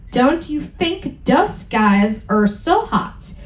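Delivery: ordinary delivery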